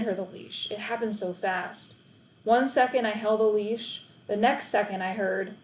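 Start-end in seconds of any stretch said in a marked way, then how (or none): none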